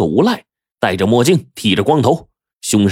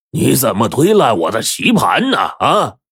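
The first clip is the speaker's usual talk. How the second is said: put-on indignant old man; 3 semitones higher than usual. A narrator's reading. Old chess player confronts the thug over his board, indignant. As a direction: confront him, affronted. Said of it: "黄三爷黑着个脸站起来".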